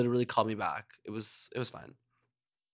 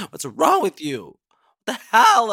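loud, high fake shock; mock outrage; 9 semitones higher, 5 dB louder than usual. Host teasing a caller with loud mock outrage. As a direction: pretend to be scandalized.

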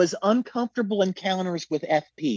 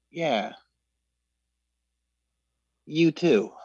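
slow, dismissive reply with a long pause; imitated disdain; 2 semitones lower, slower than usual. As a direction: mimic a star's cool disdain. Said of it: "this kind of disdain".

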